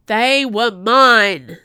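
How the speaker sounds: very loud, drawn-out howl of possession; anguished scream. A narrator's reading. Madman struggling against the guards, screaming. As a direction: bellow it slowly with all force. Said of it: "screamed the Ducomte".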